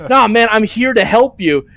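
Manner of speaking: lightly voiced chill hero; a hint of a relaxed dude voice; laid-back drow. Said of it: "the drow's like".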